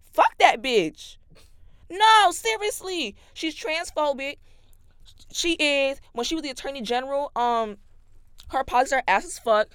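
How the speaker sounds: loud, high angry rant. Real, not acted. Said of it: "'Cause I just get so angry".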